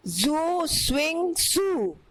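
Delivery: slow, high incantation; magic spell. A narrator's reading. The witch cursing the king, slow high incantation. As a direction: intone the spell slowly, like a witch.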